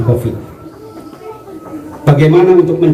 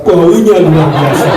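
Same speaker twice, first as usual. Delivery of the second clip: laughing punchline; slower than usual. Retold or imitated. Punchline of the joke.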